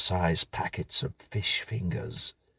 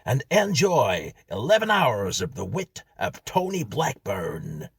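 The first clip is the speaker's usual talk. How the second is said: higher, louder American hype; American announcer; 6 semitones higher, 5 dB louder than usual. Acted. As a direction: oversell the act like a jingle. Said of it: "said the same American voice".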